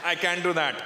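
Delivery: put-on deliberate Indian-accented refusal turning into a deal; Indian slave Raj. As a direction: play a stubborn Indian haggler, pausing between phrases. Acting Raj answering the slave owner.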